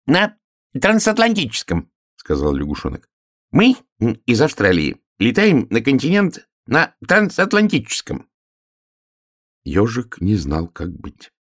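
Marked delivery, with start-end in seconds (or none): 0.1-1.9 put-on very high, piping frog child; little frog voice. Little frog answers the hare's question, piping. 3.5-8.3 put-on high, piping frog child; little frog voice. Little frog explains flights from Australia, piping.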